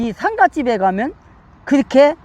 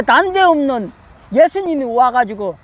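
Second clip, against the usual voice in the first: normal talking voice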